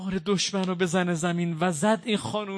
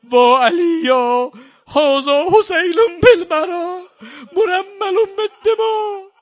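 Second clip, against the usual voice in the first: slow, loud, rising wail with sobs; drawn-out keening cry; cry of lament; 10 semitones higher, 11 dB louder, slower than usual. Acted. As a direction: wail the names out in grief. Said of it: "فریاد زد".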